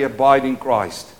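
"Abide" stressed, loud and clear for the church to echo; call and response. Shown as a demonstration. Pastor leading the congregation in a spoken response, firm and loud. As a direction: lead the congregation in "Abide in Christ". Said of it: "everybody say".

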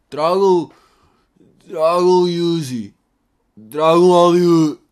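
loud, drawn-out goofy request voice; mock dopey fan calling out names, slowly; put-on voice, each request stretched and pushed; mimicking suggesters. Mimicking people's guest suggestions.

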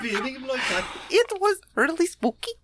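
put-on slow, high Russian accent; mock Russian accent. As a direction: drag it out in a hammy Russian accent. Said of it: "one of those Russians".

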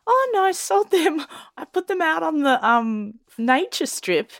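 high-pitched comic mum impression; imitated mum. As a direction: play mum casually confessing. Imitating mum's breezy reply.

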